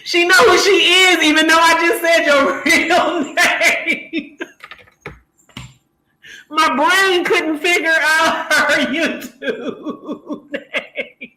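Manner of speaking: loud, slow talk broken by laughter; laughing through words. Real, not acted. Laughing at herself for saying a viewer's real name. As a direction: laugh as you try to explain. Laughing at her own mistake.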